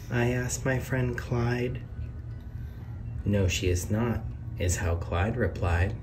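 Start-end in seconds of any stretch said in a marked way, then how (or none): none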